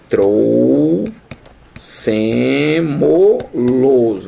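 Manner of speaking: very slow, each syllable stretched out. Shown as a demonstration. Teacher breaking down a word for students, syllable by syllable. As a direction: drag the word out piece by piece.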